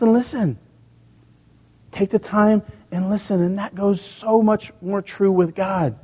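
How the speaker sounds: preacher lifting to a passionate pitch; fervent preaching. Acted. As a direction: press the point home to the congregation.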